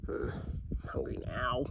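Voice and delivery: slow tired whisper. Real, not acted. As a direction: sigh it out, barely voiced. Tired hungry aside.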